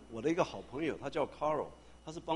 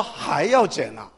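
loud, slow, laughing disbelief; incredulous surprise; 13 dB louder, much slower than usual. Retold or imitated. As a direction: stretch it out in amused shock. Landlord asked for more rent cut.

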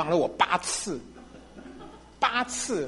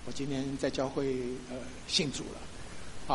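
hushed; 5 semitones lower, 11 dB quieter, slower than usual. Retold or imitated. Confessing faith to wife, hushed.

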